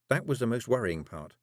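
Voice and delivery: plain reading voice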